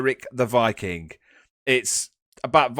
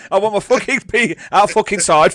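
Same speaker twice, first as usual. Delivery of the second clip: louder, ranting movie quote; quoted furious rant; 2 semitones higher, 7 dB louder than usual. Retold or imitated. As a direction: bellow the rant like an enraged traveller. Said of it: "do the bit".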